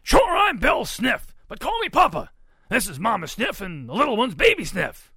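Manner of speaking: high, very loud hearty shout; booming Papa pig. A narrator's reading. Papa pig presents his family to Ruby, booming. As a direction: shout the introductions with bluster.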